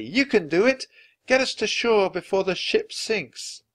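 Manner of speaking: put-on high-pitched child's encouragement; urgent encouragement. Annie urging the serpent to push the ship, encouraging. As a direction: urge it on eagerly.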